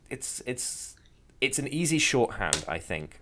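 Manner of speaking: ordinary talk, nothing marked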